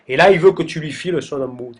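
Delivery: normal talking voice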